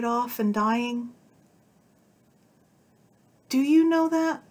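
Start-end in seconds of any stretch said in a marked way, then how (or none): 3.5-4.4 high, loud, urgent ask; delirious mind insisting; feverish question. A narrator's reading. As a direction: press the question wildly.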